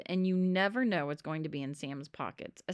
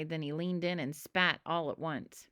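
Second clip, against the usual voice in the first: ordinary delivery, nothing marked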